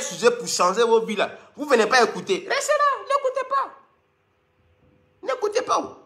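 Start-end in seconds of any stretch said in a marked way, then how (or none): 2.5-3.7 high, thin mocking voice; high squeaky taunt; mock falsetto. 5.2-6.0 mock falsetto. Repeats the taunt in falsetto.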